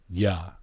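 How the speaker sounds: soft, reverent "Yah." said slowly; divine name. Shown as a demonstration. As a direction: let "Yah." land gently as the name. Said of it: "our Father's name".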